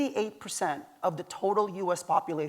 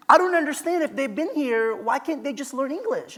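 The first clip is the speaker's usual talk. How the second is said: much higher, puzzled complaining voice; indignant woman; 7 semitones higher than usual. Retold or imitated. The woman in the soda aisle, higher and puzzled. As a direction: play her baffled, pitch up. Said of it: "and she said".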